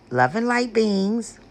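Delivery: ordinary talk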